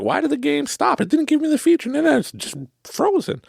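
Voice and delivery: high-pitched imitation of an upset player; imitated indignant, bewildered complaint; mock confused anger.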